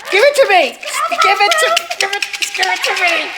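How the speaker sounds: high, squeaky little demand. Acted. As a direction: squeak it out like a tiny critter.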